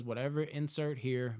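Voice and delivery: ordinary talk, nothing marked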